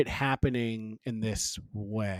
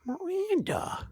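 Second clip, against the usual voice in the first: high, drawn-out impression voice; Steve; 8 dB quieter, slower than usual. Podcaster showing a co-host their Steve impression, high and drawling. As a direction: stretch the name out, pitched way up. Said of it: "I can do a Steve impression".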